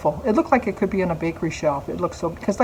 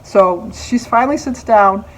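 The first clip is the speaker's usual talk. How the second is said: ordinary talk, nothing marked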